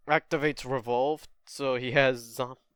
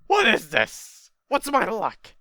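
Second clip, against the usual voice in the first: exasperated outburst; 7 semitones higher, 6 dB louder, slower than usual. Acted. Acts exasperation at a bad draw.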